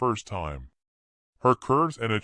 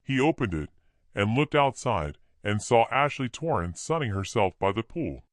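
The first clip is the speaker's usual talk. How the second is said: ordinary delivery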